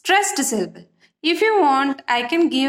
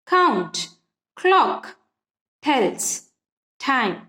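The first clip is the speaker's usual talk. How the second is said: each word alone, slow and separated; stressed syllables; much slower than usual. Shown as a demonstration. Teacher demonstrates the stressed beats to students, word by word. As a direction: tap out count, clock, tells, time one by one. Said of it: "here we have stress".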